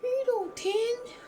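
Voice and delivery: mock her mother's doubting line; imitating mom. Quoting mom about her tan, high whispered mimic. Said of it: "that's another thing my mom said".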